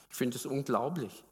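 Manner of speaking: usual speaking voice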